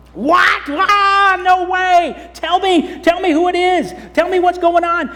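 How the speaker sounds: high, loud shocked king; alarmed king. Acted. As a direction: demand answers in shock.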